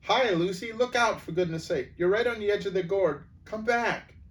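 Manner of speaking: high, loud calls from far behind; shouting a warning. Acted. Children calling Lucy back from the edge, shouting high and loud. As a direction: yell to stop her at the cliff edge. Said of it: "others shouting".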